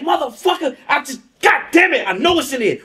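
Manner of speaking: high, exasperated venting; frustrated cursing. Retold or imitated. Aliko venting his frustration on the couch, high and exasperated. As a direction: let the frustration spill out high.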